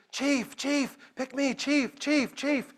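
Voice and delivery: put-on high, pleading kid's voice; a young boy calling for the leader's attention; eager little boy. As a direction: beg to be picked like an excited boy on tiptoe. Eager boy waving his hands.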